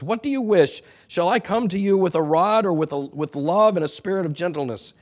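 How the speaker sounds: stern challenge. Retold or imitated. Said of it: "Look at how he ends it. He says".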